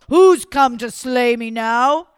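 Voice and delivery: very loud, slow giant roar; booming giant. Acted. Giant challenging the newcomer, slow and booming.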